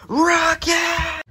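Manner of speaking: high, loud attack call; attack shout. Acted. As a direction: yell the move name.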